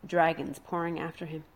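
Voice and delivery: ordinary delivery, nothing marked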